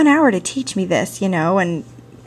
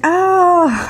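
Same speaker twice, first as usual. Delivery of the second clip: surprised oh; 9 semitones higher, 5 dB louder than usual. Retold or imitated. Woman finally understands the words.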